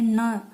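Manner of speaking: न हल्का नाक से ज़ोर देकर; न को नाक से गूंजाकर बोलिए; nasal n sound. Shown as a demonstration. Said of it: "इसका उच्चारण करके देखिए". (नाक से साफ़ न बोलिए)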